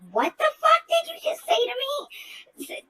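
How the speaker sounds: very high, slow, outraged retort; high, slow mock-outraged what the fuck; retold furious yell. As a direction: relive the moment you snapped at him. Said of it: "I start yelling at him".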